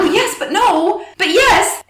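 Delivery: high-pitched, flustered back-and-forth; worked up. Retold or imitated. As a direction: re-enact getting worked up, pitch jumping way up. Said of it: "kinda getting worked up".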